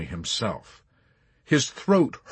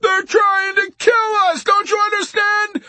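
desperate high screaming; frustrated shriek; 8 dB louder than usual. A narrator's reading. Yossarian warning Arfi under flak, frustrated shriek. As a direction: scream it to make him understand. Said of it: "Yossarian shrieked in frustration".

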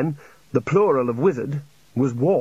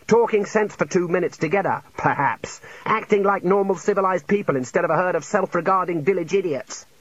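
higher-pitched scornful tirade; exasperated rant; 6 semitones higher than usual. A narrator's reading. Junior wizard berating muddled seniors, exasperated. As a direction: pile up the complaints without real shouting. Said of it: "Ponder shouted".

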